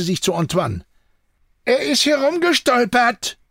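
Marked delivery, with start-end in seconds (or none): 1.6-3.4 high-pitched call back; shouted reply. A narrator's reading. Someone outside answers Jean, shouting back. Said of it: "schallte es zurück".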